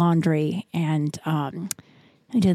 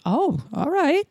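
higher, surprised agreement; pleasantly surprised; 8 semitones higher, slower than usual. Retold or imitated. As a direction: act taken aback, then go along. Surprised reply to willing child.

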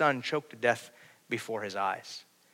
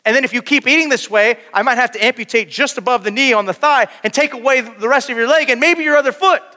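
high, loud preaching; preaching at full force; 12 semitones higher, 8 dB louder than usual. Acted. Preacher warning his congregation about sin and health, loud. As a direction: thunder it out to the congregation.

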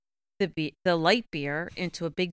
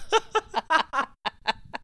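high laugh; 10 semitones higher than usual. Real, not acted. Laughs at own joke.

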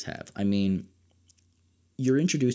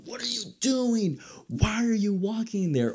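much higher, yelling at a horror character; mock screaming at the screen; 14 semitones higher than usual. Horror fan yelling at characters on screen, mock panicked shout. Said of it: "every time you're just screaming".

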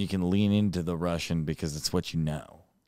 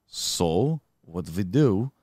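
put-on Russian accent; slower than usual. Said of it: "We gotta get Russian again".

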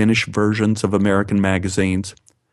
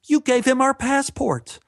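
imitating distressed girlfriend; 14 semitones higher than usual. Girlfriend Anne panics at the embassy, high distressed voice. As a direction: play Anne frantic, voice climbing high. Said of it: "Anne came up to me in distress".

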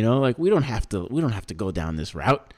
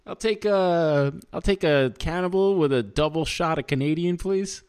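higher-pitched, put-on Canadian ordering voice; joking Canadian voice, pitched up; mock Canadian accent; 6 semitones higher than usual. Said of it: "what is that accent".

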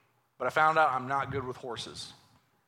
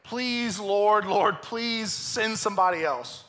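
drawn-out, pleading Moses, a little higher; 5 semitones higher, 4 dB louder, slower than usual. Acted. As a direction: beg slowly, Lord please. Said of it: "Moses pleaded again".

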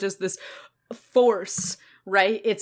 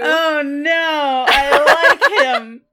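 fangirl anguish; 6 semitones higher, 7 dB louder, much slower than usual. Acted. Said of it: "fan despair".

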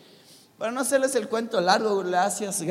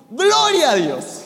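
joyful praise; 5 semitones higher, 8 dB louder, slower than usual. Real, not acted. Preacher exulting to congregation.

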